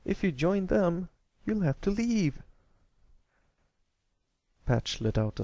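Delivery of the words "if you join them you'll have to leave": put-on high pleading young colt; a small colt begging his brother to stay; upset young colt. A narrator's reading.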